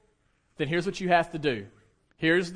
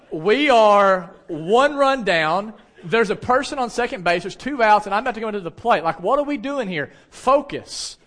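raised, loud competitive rebuke; exasperated scolding; 5 semitones higher, 7 dB louder than usual. Retold or imitated. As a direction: chew out a teammate mid-game, voice pushed up. Retold scolding of teammate mid-game.

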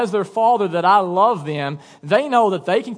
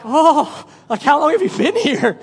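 high, loud comic fright; acted startled; 8 semitones higher, 4 dB louder than usual. Parent wakes to a child staring at them, mock startled. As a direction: jump out of your skin, playfully.

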